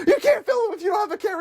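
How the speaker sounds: high, fast disbelief; hammed-up scolding; exasperated. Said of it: "it's like".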